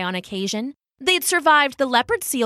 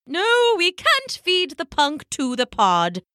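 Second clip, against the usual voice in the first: high, scolding elder penguin; high-pitched telling-off; Pippo; 8 semitones higher than usual. A narrator's reading. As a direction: refuse with a stretched, indignant no. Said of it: "She glared at Poppo".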